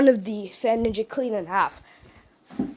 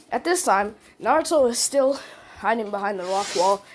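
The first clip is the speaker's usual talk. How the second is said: ordinary delivery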